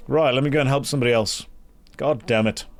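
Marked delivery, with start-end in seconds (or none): none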